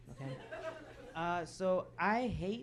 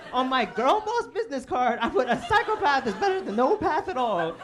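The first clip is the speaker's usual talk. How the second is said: loud, high-pitched exclamation; emphatic repeat; 6 semitones higher, 12 dB louder than usual. Shown as a demonstration. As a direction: belt it out for anyone who missed it. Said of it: "I don't know if you heard that correctly".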